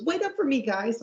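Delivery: squeaky-high cartoon plea; very high-pitched imitation. Imitating a character dropping his fake voice, very high-pitched. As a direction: push it way up into a mock falsetto. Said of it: "high-pitched real voice".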